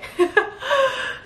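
a high, excited laugh that falls; giddy high laugh. Real, not acted. Graduation day giddiness.

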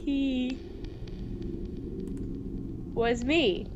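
ordinary talk

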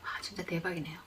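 awed whisper. Real, not acted. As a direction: breathe it out in wonder. Whispered amazement while eating. (감탄하며 속삭이듯)

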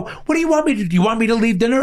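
high-pitched, exasperated retort. Retold or imitated. Dad attacked by his kids at dinner, exasperated. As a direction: plead high, cornered by your own children.

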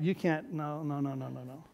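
ordinary talk, nothing marked

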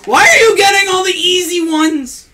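high, loud whiny protest; exasperated shout. Real, not acted. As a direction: shout it in disbelief. Exasperated at partner's easy cards.

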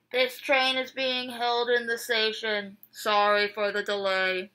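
loud conductor announcement voice; train conductor. Retold or imitated. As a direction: read it like the crackly train PA. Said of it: "They said".

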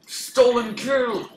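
loud, slow indignant cry; outraged shout. Real, not acted. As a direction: yell it at the thief. Loud outrage at stolen kill.

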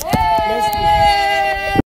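long, high-pitched whoop; excited cheer. Real, not acted. Host cheering on the birthday surprise, drawn-out high whoop.

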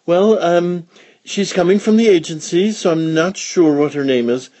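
high, hesitant and vague; mother pig covering that she doesn't know; flustered Mother Pig. Acted.

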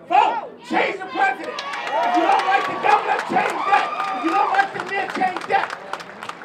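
imitating a speaker's fiery call; rousing quoted exhortation. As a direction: fire off each command to the crowd. Quoting Ollie's rousing call to vote.

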